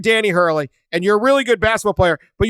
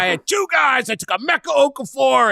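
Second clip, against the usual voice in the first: imitating Calhoun; 7 semitones higher than usual. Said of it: "But he's like".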